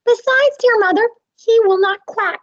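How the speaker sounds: high, loud tattling duckling. Acted. First duckling telling mother he won't quack. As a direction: whine it to mother like a little tattletale.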